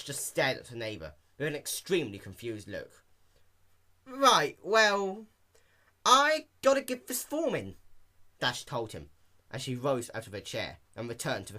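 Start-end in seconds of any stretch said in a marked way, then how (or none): 4.1-7.8 higher, louder hurried brush-off; awkward excuse. A narrator's reading. Dash escaping the old pony, high awkward brush-off. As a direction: get away politely.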